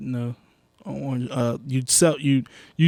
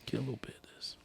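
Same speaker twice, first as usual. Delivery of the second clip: much softer, breathy whisper close to the mic; ASMR whisper; 20 dB quieter, much faster than usual. Real, not acted. Sniffing the whisky for the viewers, in a soft ASMR whisper. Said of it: "A S M R popping".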